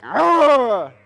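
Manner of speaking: loud angry growling noise; a loud mock-angry roar for the children. Shown as a demonstration. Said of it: "he makes an angry noise like".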